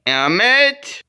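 high pretend shout and answer; calling out. Calling Emmett for school in play, calling out.

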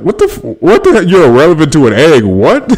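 high-pitched, incredulous reaction; laughing, thrown-off questioning; baffled disbelief. Retold or imitated. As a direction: repeat it back in amused bewilderment. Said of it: "I was like".

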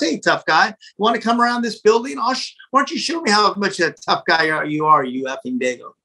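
half-acted tough-guy taunt; retold taunting. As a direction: goad him into a fight, imitating. Retold taunts from angry man.

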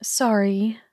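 ordinary delivery

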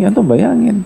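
ordinary talk, nothing marked

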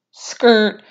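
high, squealed "skrrt"; tire screech imitation. Fan showing Jon speeding back North, squealed screech noise. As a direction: squeal like tires peeling out.

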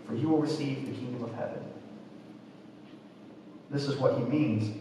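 normal talking voice

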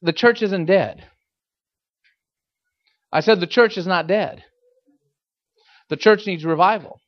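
usual speaking voice

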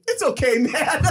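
high, laughing "it's okay"; laughing forgiveness. Retold or imitated. Hugging the guy who fouled him, laughing high. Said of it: "I'm like".

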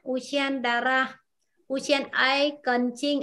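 slow, careful Uchien dara for students to repeat; teacher's slow model reading; slow demo. Shown as a demonstration. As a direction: spell out the words slowly. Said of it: "thi hoặc là xét nghiệm".

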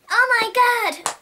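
loud excited scream of surprise; shrieking with delight; amazed shout. Acted. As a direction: burst out in amazement.

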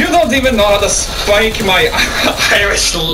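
high, put-on Scottish accent; hammed-up Scottish indignation; mock Scottish accent. Scolding a teammate about language, mock Scottish accent. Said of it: "I'm a Scottish, just by the voice".

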